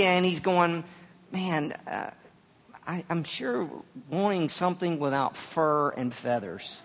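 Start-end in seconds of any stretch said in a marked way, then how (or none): 1.3-6.8 soft, hesitant thinking aloud; quiet musing. Acted. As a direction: muse to yourself with halting pauses. Acting Adam surveying the animals.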